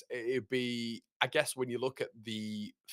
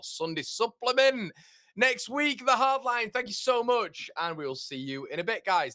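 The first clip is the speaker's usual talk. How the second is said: excited sign-off; 10 semitones higher, 6 dB louder than usual. Real, not acted.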